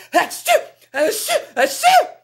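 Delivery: loud rising fake sneezes; three hearty acted sneezes; big sneezes. Ragman sneezing hard on a chilly day, loud. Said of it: "finished his sneeze".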